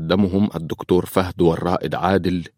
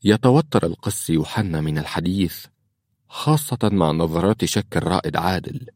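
ordinary delivery, nothing marked

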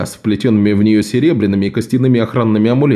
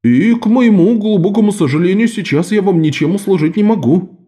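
higher, mock-sorry boatman voice; 7 semitones higher than usual. A narrator's reading. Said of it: "добавил лодочник".

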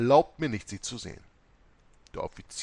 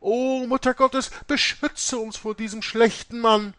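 nuns crying out; 13 semitones higher, 8 dB louder than usual. A narrator's reading. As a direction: cry out for protection, high. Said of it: "und riefen".

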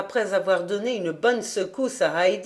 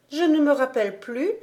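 put-on higher small child's voice; little girl; 6 semitones higher than usual. A narrator's reading. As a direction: answer innocently, lightly voiced.

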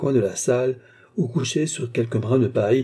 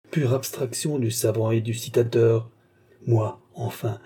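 usual speaking voice

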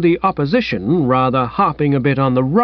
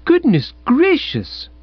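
gushing exclamation; 13 semitones higher, slower than usual. Acted. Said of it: "she repeated".

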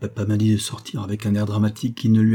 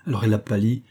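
plain reading voice

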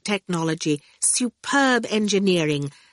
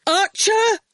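high, raised call for attention; 14 semitones higher, 4 dB louder than usual. A narrator's reading. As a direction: call up to someone at a distance. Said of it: "Howard called out".

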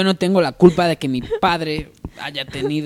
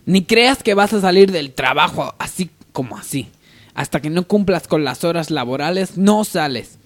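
louder scolding boss; stern boss; 5 semitones higher, 7 dB louder than usual. Acted. Boss refusing to let her leave, louder. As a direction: lay down the law.

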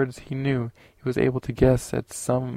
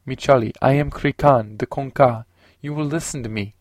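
commanding; 6 dB louder than usual. A narrator's reading. Commanding claim of rank.

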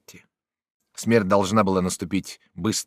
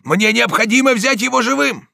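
high, loud, urgent order; desperate shout; 8 dB louder than usual. A narrator's reading. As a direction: shout over the fighting. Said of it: "кричал Дон Иниго". (отчаянный громкий крик)

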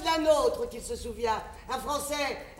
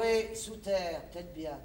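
ominous murmur; 6 semitones lower, 5 dB quieter, slower than usual. Acted. Prédiction sinistre.

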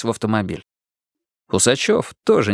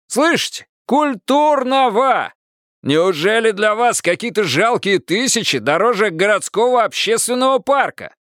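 straining to be heard; 10 semitones higher than usual. A narrator's reading. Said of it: "надрывался Пересолин".

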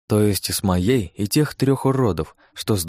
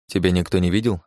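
businesslike; 2 semitones lower than usual. A narrator's reading. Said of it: "Совсем другим тоном поинтересовался мужчина".

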